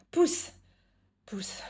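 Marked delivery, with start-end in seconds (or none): 0.1-0.5 high, loud squeal; squealed push. Acted. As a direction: yelp it out while tickled. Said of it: "Non, sérieux, stop".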